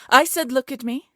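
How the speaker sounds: enraged queen shouting; furious shout. Acted. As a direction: blast the order at her. Queen repeats ignored command.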